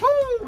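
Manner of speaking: high-pitched "woo"; a short, high whoop. Real, not acted. Cleaning the car with the viewers along, a high whoop.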